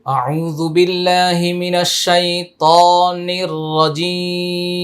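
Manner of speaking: slow, high chanted tajweed recitation; Quran recitation. Acted. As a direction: draw each letter out long and melodic.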